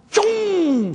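high loud whoosh; whoosh sound effect. Retold or imitated. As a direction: make the money fly. Imitated button sound.

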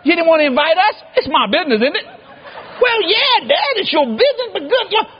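high-pitched, climbing mock disbelief; retold incredulity. Son questions Dad about his secret wedding, acted disbelief. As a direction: question Dad in rising, stunned comic outrage.

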